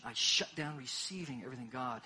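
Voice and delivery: usual speaking voice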